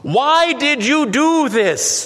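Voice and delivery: high, slow call down over the rail. A narrator's reading. Man calling down to the dangling stranger, a high slow call. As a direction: call it out in despair. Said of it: "the man called out".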